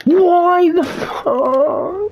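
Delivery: very high, slow, loud wail; drawn-out anguished yell. Real, not acted. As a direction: stretch it out in disbelief. Game failure, drawn-out cry.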